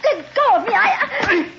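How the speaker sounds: high, breathless cries of protest; panicked struggle. Acted. Captive woman fighting the captain's hold, panicked. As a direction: wrench away and cry out.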